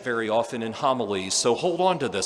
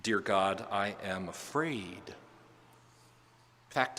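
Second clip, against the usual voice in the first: softer, almost whispered prayer; hushed prayer; 4 semitones lower, 7 dB quieter, much slower than usual. Retold or imitated. Priest voicing his own prayer to God, hushed and softer. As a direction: confide in God under the breath. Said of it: "talk with God".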